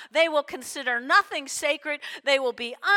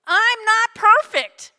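raised and slowed, pressing each word; emphatic preaching; 7 semitones higher, 8 dB louder than usual. Real, not acted. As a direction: drive home that he's flawed.

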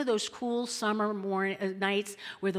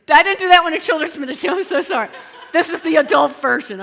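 loud, fast and laughing; laughing apology; 6 semitones higher, 14 dB louder, faster than usual. Real, not acted. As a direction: burst out laughing while apologizing to the crowd. Laughs at own grim story.

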